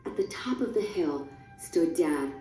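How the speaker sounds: plain reading voice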